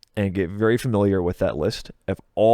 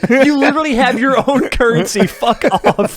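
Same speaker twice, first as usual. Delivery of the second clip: laughing high and loud through the words; can barely get it out for laughing; 14 semitones higher, 8 dB louder than usual. Real, not acted. To a friend joking about own currency, laughing loudly.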